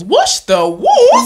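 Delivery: high, loud, slow disbelief; incredulous. Real, not acted. Repeating mum's chore in disbelief to friends, high and loud. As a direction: stretch it out in shock.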